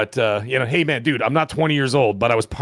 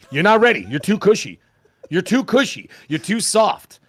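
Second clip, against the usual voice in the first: taunting; 7 semitones higher, 3 dB louder than usual. Real, not acted. Taunting the Dodgers.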